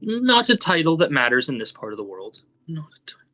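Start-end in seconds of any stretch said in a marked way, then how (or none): 2.7-3.2 soft whispered echo, barely voiced; whispered aside. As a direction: breathe it under your breath. Whispered aside repeating own line.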